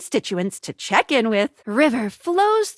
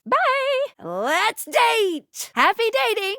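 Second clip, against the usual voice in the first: high-pitched, playful game-host farewell; chirpy sign-off; 7 semitones higher, slower than usual. Acted. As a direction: send the player off brightly, in a raised voice.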